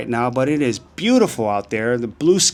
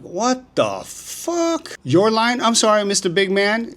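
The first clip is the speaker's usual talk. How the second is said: much higher, incredulous retort in a slow drawn start; indignant outburst; 8 semitones higher, slower than usual. Retold or imitated. Retelling talking back to a shirtless man on the sidewalk, indignant high outburst. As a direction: act out snapping back at a rude stranger. Retold sidewalk quarrel with stranger.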